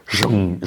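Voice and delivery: mouthed metallic shutting sound; quick imitated whoosh-thud of a hatch; vocal sound effect of a heavy hatch closing; hatch slam noise. Imitates a hatch slamming shut.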